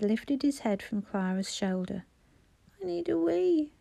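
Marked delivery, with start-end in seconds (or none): 2.8-3.7 put-on high, thin little-boy voice; murmuring small child. A narrator's reading. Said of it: "he murmured".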